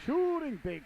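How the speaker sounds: sudden high, loud burst on the shot; excited shot call. Real, not acted. Commentator calling the shot, suddenly high and loud. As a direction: jump up as the shot goes.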